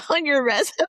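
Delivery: high loud laughing question; laughing disbelief. Real, not acted. Friend laughing at the resume joke, loud and high. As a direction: burst out laughing in surprise.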